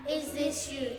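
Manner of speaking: ordinary talk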